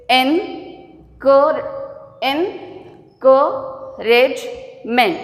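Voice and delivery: "encouragement" restarted after "en-", very slowly with gaps; broken into syllables. Shown as a demonstration. Teacher asking how we break it, "encouragement" syllable by syllable. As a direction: spell out "encouragement" beat by beat in four parts. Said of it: "how can we break".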